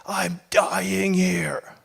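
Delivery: breathy, drawn-out whisper of despair; slow hushed plea; desperate whisper. Acted. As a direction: whisper it like you're at the end of your rope. Desperate plea voiced in quote.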